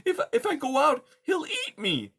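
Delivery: tiny timid mouse voice, high; timid little mouse. Acted. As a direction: squeak it out like a scared mouse. Poppy speaking.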